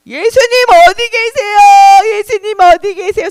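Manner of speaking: high, loud searching call; acted desperate calling, pitched high. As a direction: cry out for someone missing.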